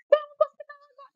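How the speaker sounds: high, fast mock order; mock frantic husband. Wife mimics husband rushing her to hospital, high and fast. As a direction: rush it like a scared husband. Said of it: "assim, ele".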